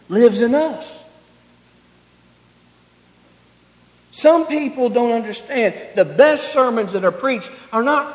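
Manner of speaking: loud, driving preacher cadence; preaching at full force. Acted. Preacher pleading with his congregation, loud. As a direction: lift the room with the message.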